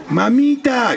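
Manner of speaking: put-on high, excited little boy's call; child calling out. A narrator's reading. Little boy calls to his mother as she comes home, high and eager. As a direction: call out to mum like a small boy. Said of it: "gritó el gurí".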